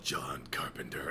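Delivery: low, hushed movie-trailer announcer. Retold or imitated. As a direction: intone the name slowly, deep and whispery like a trailer. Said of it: "that, uh, trailer voice that I do".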